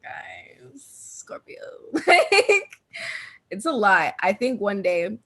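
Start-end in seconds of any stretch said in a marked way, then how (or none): 0.0-1.6 whisper. Retold or imitated. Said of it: "I'm like". 1.9-2.7 laughing. Real, not acted. Laughing after whisper bit.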